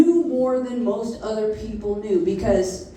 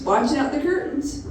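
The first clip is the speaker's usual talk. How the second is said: ordinary delivery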